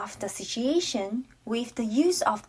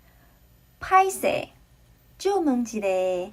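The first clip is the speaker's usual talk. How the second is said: slow drill; slower than usual. Shown as a demonstration. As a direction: draw out 拍谢 slowly, then 救问一下. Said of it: "So try it".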